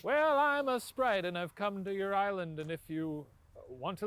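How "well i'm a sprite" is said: high, slow, lightly voiced sprite; slow, high-pitched announcement as a sprite; sprite impression. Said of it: "That's your impression of a sprite?".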